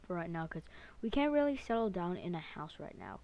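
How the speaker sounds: usual speaking voice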